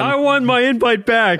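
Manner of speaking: high, loud, slow comic whine; hammed-up plea to get the invite back; mock whine. Joking to Tom about the lost invite, mock whining.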